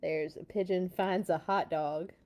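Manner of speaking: ordinary delivery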